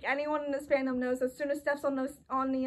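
ordinary talk, nothing marked

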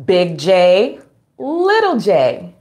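ordinary talk, nothing marked

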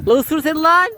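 high, loud teasing urge; playful egging on. Real, not acted. Owner egging on her dog at play, high loud tease. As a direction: egg the dog on, laughing inside.

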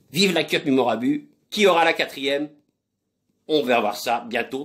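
usual speaking voice

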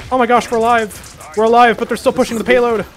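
high, loud relieved cry; thrilled to be alive; excited relief. Real, not acted. Relieved, still frantic.

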